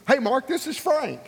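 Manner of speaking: high-pitched, cheery retold phone greeting; lifted, animated hello down the line; upbeat caller. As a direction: imitate an eager man ringing back. Voicing Frank calling back.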